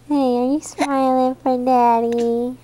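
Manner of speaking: ordinary delivery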